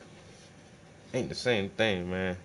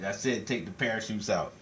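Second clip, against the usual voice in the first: calm villain doubling down; cold insistence; 6 dB quieter than usual. Acted. Villain repeats the order.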